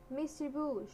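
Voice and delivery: high shout of the toy's name. A narrator's reading. Darling shouts to her caged toy, high and sharp. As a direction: cry out at seeing the toy caged. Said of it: "shouted Darling".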